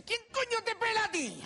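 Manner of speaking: high, incredulous punchline; acted appalled outburst; mock indignant question. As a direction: demand it in comic disbelief. Pope's punchline on the haircut.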